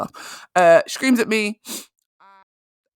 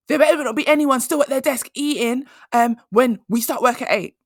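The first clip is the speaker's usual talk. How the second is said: high, raised imitation of a manager's yell; boss shouting; 7 semitones higher than usual. Manager to staff still eating, a high mock shout. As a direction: warn the room off eating, pitched up and pushy. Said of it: "she got louder and louder".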